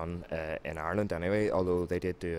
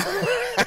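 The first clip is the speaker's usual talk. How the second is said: sudden high giggle; real laugh breaking out; burst of high laughter; 10 dB louder than usual. Real, not acted. Laughs at being thrown.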